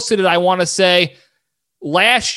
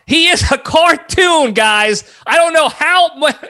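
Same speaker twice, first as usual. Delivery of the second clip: ordinary talk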